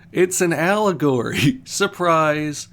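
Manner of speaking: loud, high, laughing through the punchline; spring the twist with a laugh; real laughter at own joke; laughing reveal. Real, not acted. Reveals the story was an allegory.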